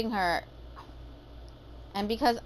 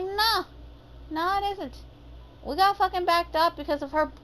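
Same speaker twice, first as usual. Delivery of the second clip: indignant denial; 8 semitones higher than usual. Retold or imitated. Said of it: "And I was like".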